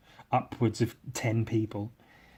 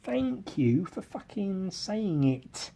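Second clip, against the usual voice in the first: mock grateful; 7 semitones higher, 5 dB quieter, slower than usual. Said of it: "And I was like".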